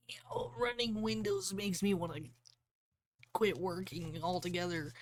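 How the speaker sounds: high, whispery put-on voice of a fed-up machine; breathy high mock complaint; mock whiny computer voice. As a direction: voice the computer as if it's sick of life.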